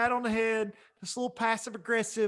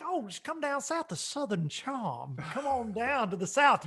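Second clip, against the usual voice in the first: mock Southern charm; 5 semitones higher, 3 dB quieter than usual. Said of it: "you're like".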